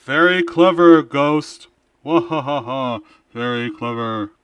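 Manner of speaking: loud, booming, like a goddess; sarcastic godlike boom with an evil laugh. Acted. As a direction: boom it down like a mocking deity. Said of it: "Do I even sound robotic or godlike".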